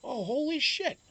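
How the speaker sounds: high, excited mock exclamation; surprised exclaim. Friend hears where speaker is from, high surprised exclaim. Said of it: "And he's like".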